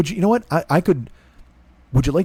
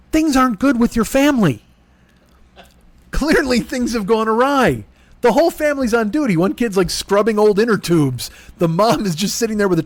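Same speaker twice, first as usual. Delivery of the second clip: mock concern; 7 semitones higher than usual. Real, not acted. Mock pity describing family.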